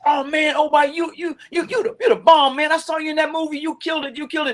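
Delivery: mock fan gushing over his movie. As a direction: gush like an awestruck fan praising a movie. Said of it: "somebody says".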